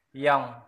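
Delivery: Ion said loud, without the leading A; loud demo word. Shown as a demonstration. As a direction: model Ion clearly. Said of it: "Cách đọc". (đọc to chữ Ion)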